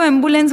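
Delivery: एम्बुलेंस as "em-bu-lens"; the habitual "em-bu-lens"; common wrong way. Shown as a demonstration. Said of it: "आज तक हम लोग".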